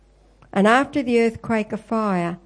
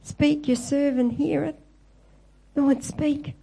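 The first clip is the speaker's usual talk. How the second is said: higher and gentle, a servant answering; humble listening prayer; 6 semitones higher than usual. Acted. Believer answering God's call, humble and higher. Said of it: "instead of saying".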